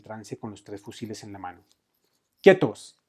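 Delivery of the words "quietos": high, loud order to halt; sudden forceful call; repeated shouted command. A narrator's reading. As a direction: shout to stop armed men. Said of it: "repitió un par de veces más".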